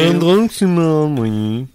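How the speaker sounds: drawn-out preachy warning; slow mock PSA. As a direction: stretch it out like a public message. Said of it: "repeats slowly". (message de prévention lent)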